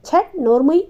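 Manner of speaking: father sharply scolding his son for saying it. A narrator's reading. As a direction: snap at the boy to shut up. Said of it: "Satyam కసరటం".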